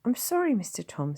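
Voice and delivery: nervous. Acted. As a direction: apologise timidly. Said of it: "said the nurse nervously".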